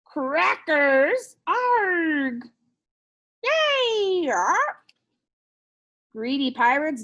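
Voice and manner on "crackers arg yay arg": high, loud squawking pirate parrot; hammed-up pirate squawk. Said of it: "Pirate says".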